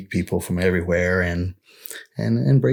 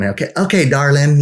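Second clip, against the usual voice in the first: higher, louder, sugary Texan server; Texan sweet talk; 6 semitones higher, 4 dB louder than usual. Retold or imitated. Texan cashier sweet-talking the visiting family, a touch higher and louder. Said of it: "because that's how we, they speak".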